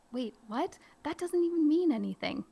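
high, puzzled double-take; fake bewildered. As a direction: act baffled by the word. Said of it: "play it up and be funny".

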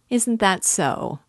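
sly persistence. A narrator's reading. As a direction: push harder, teasing, lifting "Isn't". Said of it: "persisted Svidrigaïlov with a sly smile".

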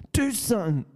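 mock-challenge them like a cocky brawler; Conor McGregor. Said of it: "I'm on my Conor McGregor ting".

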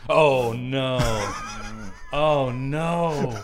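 loud, high, laughing outcry; real shocked laughing shout; loud laughing groan of disbelief. Real, not acted. Listener reacts to the shocking reveal.

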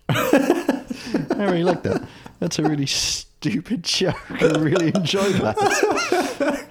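giggling through the words; high, slow laughing delight. Real, not acted. Laughing at a pun.

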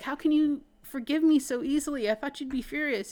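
high, loud pleading as Zuko. Retold or imitated. Zuko begging his uncle for forgiveness, high pleading.

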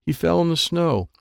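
ordinary delivery

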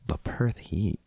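hushed repeat; whispered echo. Real, not acted. Host echoing the co-host's question in a whisper. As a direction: whisper it back, amused.

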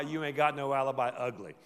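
ordinary talk, nothing marked

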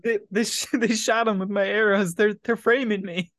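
high, loud, stammering fake alibi; hammed-up frantic accusation; mock panicked protest. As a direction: protest your innocence in playful panic. Joins in acting Hawkeye's false excuse.

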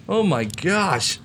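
usual speaking voice

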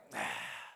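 a long weary breath out; sigh, let down; disappointed sigh. Retold or imitated. Jesus watching Peter sink, sighing in disappointment. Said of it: "Jesus standing there went like".

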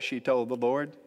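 ordinary talk

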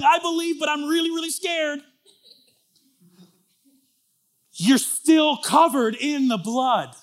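high, loud, driving sermon voice; fervent preaching. Acted. Pastor pressing the crowd about others' faith, preaching at full force. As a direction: preach it hard, building fire.

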